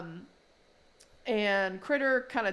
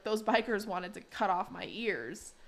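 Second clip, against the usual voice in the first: ordinary talk, nothing marked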